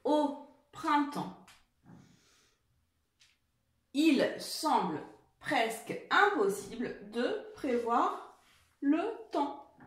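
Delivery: slow, clearly phrased dictated sentence; slow dictation. Shown as a demonstration. Teacher rereading the dictation slowly to the children. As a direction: read the sentence slowly for writing. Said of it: "Je reprends".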